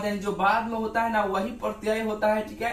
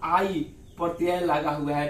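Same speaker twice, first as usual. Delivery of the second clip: ordinary talk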